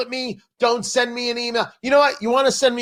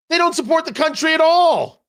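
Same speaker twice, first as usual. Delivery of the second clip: angry shout; 8 semitones higher than usual. Real, not acted. Talk host raging at leftists, shouting. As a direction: shout it out in outrage.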